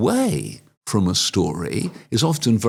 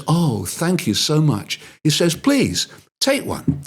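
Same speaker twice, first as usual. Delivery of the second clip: grateful seller; 7 semitones higher, slower than usual. Acted. Seller thanks and offers.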